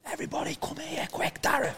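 hushed, excited whisper to the group; urgent whisper. Retold or imitated. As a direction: whisper it fast so the sergeant won't notice. Urgently calling group to film.

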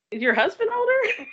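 high-pitched retold disbelief; mock incredulous question. Castmates quizzing Mia about her husband's age, mock incredulous. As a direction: act out a baffled, prying question way up high. Cast retold puzzling over ages.

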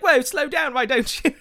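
loud, high mock boy voice; annoying teenage boy. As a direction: imitate a whining teenage game character, loud and high. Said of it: "with a horrible accent".

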